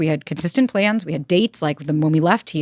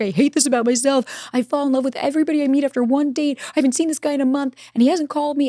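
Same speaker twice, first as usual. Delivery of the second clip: higher, mock tearful girl's voice; imitating a crying girl; 8 semitones higher than usual. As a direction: lightly play the distraught girl, pitched up. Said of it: "And she's crying hysterically".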